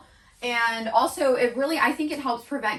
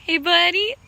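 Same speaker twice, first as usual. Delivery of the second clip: higher, louder, cheerful; 6 semitones higher, 6 dB louder than usual. Real, not acted. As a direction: greet him brightly. Greeting horse.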